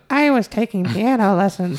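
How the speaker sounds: put-on high, frail old woman voice; old lady voice. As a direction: play an elderly lady reminiscing. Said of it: "That's my old lady voice".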